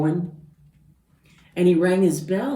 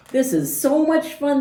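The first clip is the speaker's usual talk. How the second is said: higher, louder delighted voices; much higher gleeful cheer; happy animals; 10 semitones higher, 6 dB louder than usual. Acted. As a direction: cheer it out together. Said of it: "they all said".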